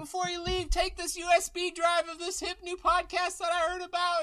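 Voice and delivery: very high promo voice; squeaky salesman. Acted. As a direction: pitch it in a squeaky voice. Pitchman voice, very high.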